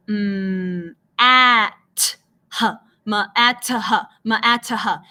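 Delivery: "mm, at, h" split up, T and H each said alone; sounding out separately. Shown as a demonstration. Teacher imitating children misreading math, "ma, at, h" in disconnected sounds. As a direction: break "ma, at, h" into pieces with a separate huff for "h". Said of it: "van a decir".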